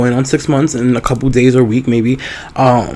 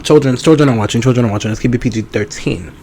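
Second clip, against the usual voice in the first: ordinary talk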